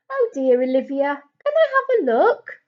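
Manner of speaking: gently. Acted. Doctor gently asking the girl with the sore ankle. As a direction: ask it gently, soothing a hurt child. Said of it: "asked Doctor Miranda gently".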